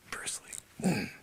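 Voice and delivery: breathy hushed awe; reverent whisper. Acted. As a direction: sigh the name like a legend. Reverent sigh.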